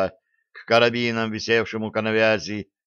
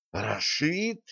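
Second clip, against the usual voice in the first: soft, high, rising disbelief; incredulous soft echo of a name; surprised question; 5 semitones higher, 6 dB quieter than usual. A narrator's reading. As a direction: ask in hushed astonishment, pitch climbing. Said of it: "в один голос удивились шотландцы".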